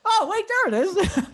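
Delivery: loud, very high squeal of fake discovery; mock scream. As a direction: play along with a piercingly high cry. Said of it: "wanted him to just scream".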